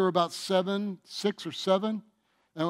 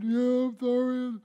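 put-on higher, slow, sulky kid's apology; sorry child; 7 semitones higher, slower than usual. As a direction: play the caught kid saying sorry. Child apologizing during discipline.

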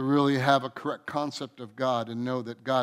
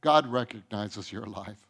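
quiet reassurance; 7 semitones lower, 5 dB quieter than usual. Shown as a demonstration. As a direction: lower and softer, "God" leaning in. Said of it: "no one even recognizes that you're alive".

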